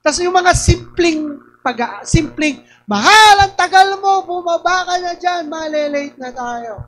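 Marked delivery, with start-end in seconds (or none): 2.8-6.8 loud, high call up the stairs. Retold or imitated. Calling to spouse to come down, loud and high. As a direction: hurry them down with a raised call.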